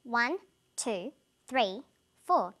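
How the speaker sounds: ONE, TWO, THREE, FOUR each its own stressed beat; deliberate metronome-like count; stress on numbers. Shown as a demonstration. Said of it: "the words I'm stressing are the numbers".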